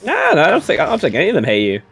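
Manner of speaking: usual speaking voice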